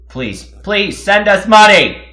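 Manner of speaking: loud, high pleading for cash; mock begging shout. Host begging listeners for money, loud mock plea. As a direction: beg the audience at the top of your voice.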